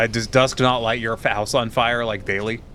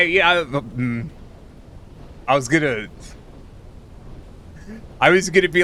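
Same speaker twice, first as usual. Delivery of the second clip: ordinary talk, nothing marked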